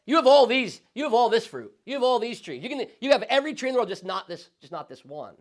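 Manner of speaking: loud, high, emphatic voice of God; booming command that softens on the warning; God's command. Acted. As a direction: proclaim it like God laying down the rule. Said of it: "And what does God tell them?".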